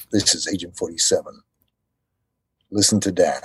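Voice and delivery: low, cool hitman voice; Agent 47 voice. Shown as a demonstration. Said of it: "a little tag for your podcast".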